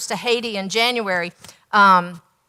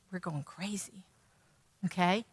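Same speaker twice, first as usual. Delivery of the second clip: muttered; 4 semitones lower, 15 dB quieter than usual. Real, not acted. Grim aside.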